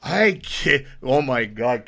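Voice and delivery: normal talking voice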